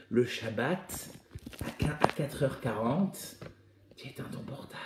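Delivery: slow, fading to a whisper on the last words; slow dramatic hush. Acted. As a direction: let it sink in, dropping to a whisper. (lent et murmuré, comme un secret)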